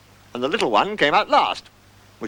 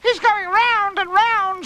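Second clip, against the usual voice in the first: very high, loud, drawn-out squeal; high-pitched wonder; much impressed; 4 dB louder, much slower than usual. Acted. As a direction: marvel slowly in a little one's high voice. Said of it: "said Roo, much impressed".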